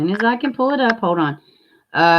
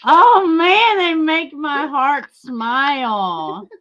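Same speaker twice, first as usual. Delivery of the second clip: high, slow, tender gush; 8 semitones higher, much slower than usual. Real, not acted. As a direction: melt over the puppies. Gushing over puppies.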